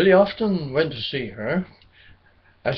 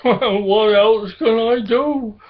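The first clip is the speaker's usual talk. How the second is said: upset; 7 semitones higher, 4 dB louder than usual. A narrator's reading. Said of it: "getting ever so upset".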